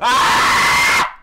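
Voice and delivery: sharp gasp then loud cry; gasp, then shriek in frustration; real gasp and yell; gasp and scream. Real, not acted. Falls again.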